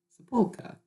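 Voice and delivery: "Sepulchre" in a high, hammed-up British accent; mock British accent. Shown as a demonstration. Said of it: "sounded very British".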